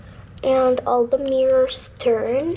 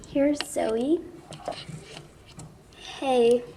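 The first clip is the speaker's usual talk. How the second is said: normal talking voice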